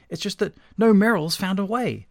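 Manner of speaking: high-pitched nervous explanation. A narrator's reading. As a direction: explain it anxiously, voice lifting. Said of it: "said Merrill nervously".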